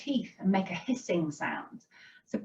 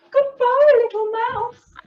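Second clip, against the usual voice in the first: very high mock-frightened farewell; storybook alarm; 6 dB louder than usual. A narrator's reading. Fox flees from the Gruffalo, high acted fright. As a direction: start loud and squeal a scared goodbye.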